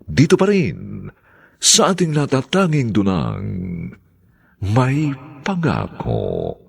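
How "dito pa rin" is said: usual speaking voice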